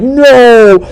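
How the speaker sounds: loud, high-pitched drawn-out protest; exasperated viewer's cry. Acted. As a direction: wail it at the screen. Acted viewer protesting at the screen.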